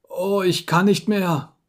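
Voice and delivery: slow, high moan of exhaustion; failing patient. A narrator's reading. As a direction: give up as if close to fainting. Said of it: "Holmes murmelte noch wenige zusammenhanglose Worte".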